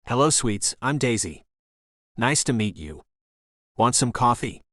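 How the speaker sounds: put-on friendly English-accented welcome; chatty broad English woman. A narrator's reading. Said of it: "said in a broad English accent".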